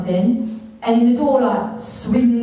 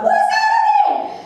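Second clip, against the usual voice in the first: freaked out squeal. Retold or imitated. Spooked by the self-flushing toilet, a high panicked squeal. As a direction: shriek it like you've just been spooked. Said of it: "I was like".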